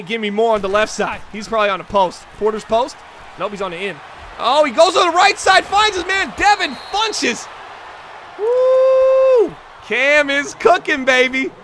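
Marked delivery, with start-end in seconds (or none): 4.4-7.5 high, loud touchdown-style call; thrilled sportscaster call; excited play-by-play. Acted. Live play-by-play call of big catch. 8.4-9.5 long, high, loud "woo"; elated holler; celebratory whoop. Real, not acted. Celebrating the big catch. 9.8-11.5 loud, slow, drawn-out cheer; hyped-up commentator praise; excited celebration. Real, not acted. Celebrating the quarterback's play.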